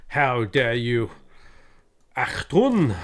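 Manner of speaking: put-on slow, indignant German accent; put-on German outrage, words drawn out; mock German accent. Streamer answering a jibe about their German, mock-offended German accent. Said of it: "I can do a German accent sometimes".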